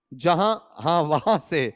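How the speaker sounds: slow, lightly voiced child answer; a hint of a little girl; imitating the girl. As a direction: mimic the pupil's cheeky reply. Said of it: "तो गुड़िया कह रही है वहां से".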